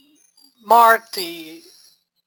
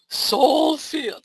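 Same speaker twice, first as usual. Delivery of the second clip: higher, sugary try at soul field; syrupy sweet attempt; 6 semitones higher than usual. Shown as a demonstration. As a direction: try to sound sugary and spiritual. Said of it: "I can't do it".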